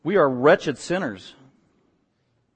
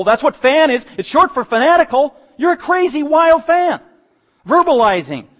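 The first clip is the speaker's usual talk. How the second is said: from strong to much louder and higher, almost shouting; building fervent preaching; 5 semitones higher, 10 dB louder than usual. Acted. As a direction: drive the crowd image up to a peak.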